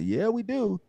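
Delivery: high-pitched retort; insisting. Retold or imitated. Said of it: "And I'm like".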